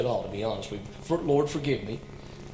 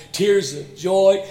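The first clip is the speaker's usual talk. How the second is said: moved exclamation; 5 semitones higher, 6 dB louder, much slower than usual. Acted. Moved preacher.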